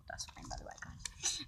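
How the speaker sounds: soft unvoiced whisper to the audience; whispered aside to the viewers about the chapter name. Real, not acted.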